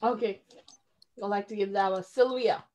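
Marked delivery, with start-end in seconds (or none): none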